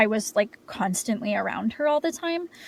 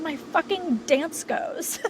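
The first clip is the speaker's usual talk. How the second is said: laughing through tears; 6 semitones higher, slower than usual. Real, not acted. Tearful laugh telling grandmother's death.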